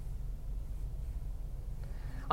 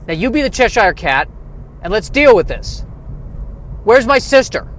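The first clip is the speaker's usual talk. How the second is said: raised, loud hammed-up demand; demanding action hero; 6 semitones higher, 3 dB louder than usual. Hero demanding his sister in sketch.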